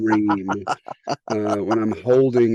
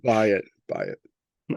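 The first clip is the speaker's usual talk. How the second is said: mock ad voice; 5 dB quieter, much slower than usual. Mock ad pitch, deep second take.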